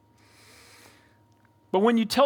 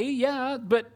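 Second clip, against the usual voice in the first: ordinary talk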